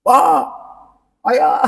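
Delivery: put-on high, loud call of a child to father; child yelling. Child shouting across the house to father, loud yell. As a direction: bellow for dad like an impatient kid. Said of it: "Kadang anak-anak kita itu teriak".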